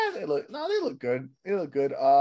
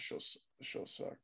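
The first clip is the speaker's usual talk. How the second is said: disgusted mutter; 19 dB quieter than usual. Real, not acted. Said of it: "disgusted aside".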